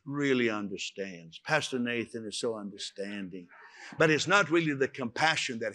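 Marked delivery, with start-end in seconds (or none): 0.0-3.5 softer, lightly gushing churchgoer; a touch of starry-eyed admiration; mock gushing praise. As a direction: imitate a charmed listener. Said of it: "go home and say".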